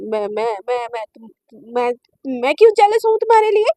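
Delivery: halting low start, then a loud rushed denial; flustered stammer. A narrator's reading. Shivani denies being jealous to Naina, flustered and stammering. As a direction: stumble over the words, then burst out defensively.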